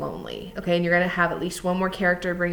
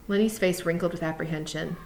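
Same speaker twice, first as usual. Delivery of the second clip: ordinary delivery, nothing marked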